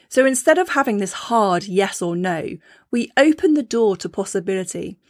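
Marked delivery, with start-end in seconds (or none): none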